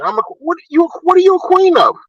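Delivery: high, incredulous scoff at the claim; mocking disbelief. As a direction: sneer it out in disbelief.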